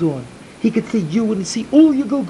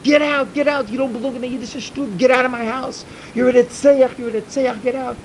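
acted angry order, pitched up; angry dismissal; 5 semitones higher than usual. Rebbe throwing a sinner out of his house, angry. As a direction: drive him out, repeating it insistently.

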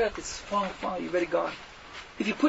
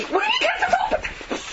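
laughing disbelief; faster than usual. Retold or imitated. Incredulous retort to sushi eater.

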